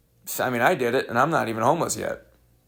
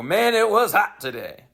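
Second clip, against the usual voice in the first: corny joke voice; 7 semitones higher than usual. Shown as a demonstration. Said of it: "so I can go".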